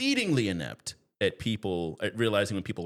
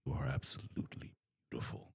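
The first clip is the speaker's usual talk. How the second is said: low, hushed murmur right into an ear; soft, dark vampire whisper; dark deep whisper; 8 semitones lower, 11 dB quieter than usual. Acted. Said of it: "deep voice".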